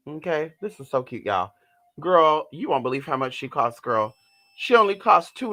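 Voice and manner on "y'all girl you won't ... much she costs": ordinary delivery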